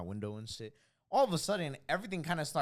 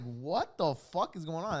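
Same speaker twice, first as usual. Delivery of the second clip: raised, slow mock alarm; hammed-up baffled outcry; retold bewilderment; 5 semitones higher, 5 dB louder, slower than usual. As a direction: drag it out loudly in disbelief. Retells his alarm during the quake.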